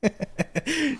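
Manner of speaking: high giggling burst; soft, high chuckle; laugh. Real, not acted. Stories might not be safe to hear, high giggle.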